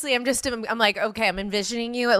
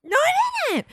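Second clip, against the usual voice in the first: very high squeaky mock crack; imitated voice crack; slower than usual. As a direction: let the voice crack sky-high, mocking him. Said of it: "I can do it when your voice scre-, your voice cracks".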